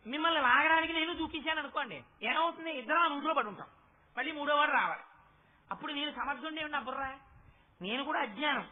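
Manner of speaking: ordinary talk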